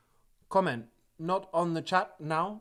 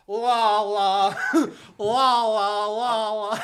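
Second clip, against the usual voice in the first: la la, louder and higher, tongue flapping; imitated la la; 6 semitones higher, 10 dB louder than usual. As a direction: imitate the tongue-slip la la, hammed up and loud. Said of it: "Exactly like that with my tongue".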